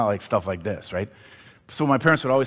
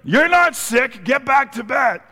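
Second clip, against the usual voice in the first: hammed-up mom, much higher and slow; mom insisting; 13 semitones higher, 9 dB louder, slower than usual. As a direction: repeat the mother's dismissal, drawn out and high. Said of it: "My mom looks at me and goes".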